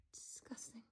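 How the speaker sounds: hushed, revolted word; disgusted whisper. Real, not acted. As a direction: breathe it out in disgust.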